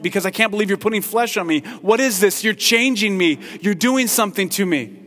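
a toy sputtering in outrage; fast, high, loud toy complaint; indignant toy. Retold or imitated. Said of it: "this toy would probably look at you and say".

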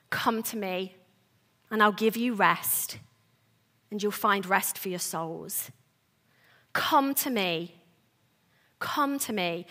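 soft, low, unhurried invitation; tender invitation. Acted. Preacher voicing Jesus' call to rest, tender. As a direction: gently draw them in, low and quiet.

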